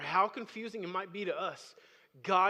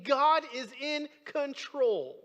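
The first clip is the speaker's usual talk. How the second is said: high, loud preaching with punched key words; emphatic preaching; 7 semitones higher, 8 dB louder than usual. Real, not acted. Preacher assuring the congregation God rules, emphatic preaching. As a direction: preach it with full conviction.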